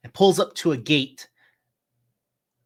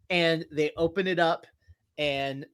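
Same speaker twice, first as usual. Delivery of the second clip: ordinary talk, nothing marked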